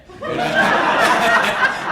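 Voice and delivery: loud, high burst of laughter; real open laugh after the quip; big laugh. Real, not acted. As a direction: laugh out hard at your own joke. Laughs at own joke.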